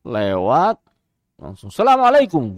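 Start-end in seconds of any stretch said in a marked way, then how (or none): 1.7-2.5 quick, higher salam tossed off in passing; hurried greeting. Retold or imitated. Hurried sheikh greeting the guard in passing. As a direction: rush the salam while walking past. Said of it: "dia tergesa".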